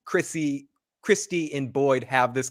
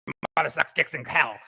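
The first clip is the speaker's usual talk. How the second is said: put-on possessed girl. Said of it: "the exorcist in her".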